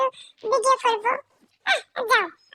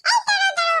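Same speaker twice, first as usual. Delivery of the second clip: calling after; 9 semitones higher, much faster than usual. Pretend. Pups chase departing granny.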